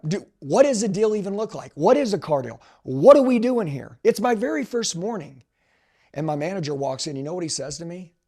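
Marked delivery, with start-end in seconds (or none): 0.0-5.4 louder, high-pitched, incredulous questions; bewildered outburst. Retold or imitated. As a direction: blurt it out, totally out of your depth. Acts out bewildered first-day self.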